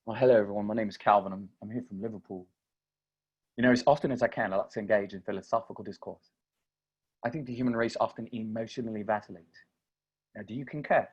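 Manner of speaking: formal, soft-spoken English accent; Liverpool English accent. Shown as a demonstration. Philosopher from Liverpool greeting an audience, soft English accent. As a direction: put on a learned English accent, measured and polite. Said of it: "talk like this".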